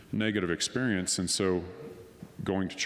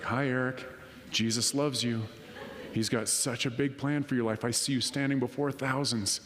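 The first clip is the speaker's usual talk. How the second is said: lightly voiced devout lady, pitched higher; sweet believer; 4 semitones higher than usual. Customer prophesying over her waiter, lightly imitated sweet voice. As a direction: imitate a cheerfully earnest churchgoing customer.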